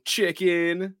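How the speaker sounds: high, drawn-out playground taunt; mock taunting. Kids teasing each other to go into the house, mock taunting.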